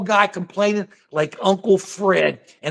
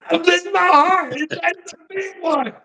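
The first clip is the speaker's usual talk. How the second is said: high, wailing sitcom heart-attack act; hammed-up high-pitched dying routine; Fred Sanford; 9 semitones higher than usual. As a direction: clutch your chest and cry out theatrically. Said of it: "Oh, how great was he?".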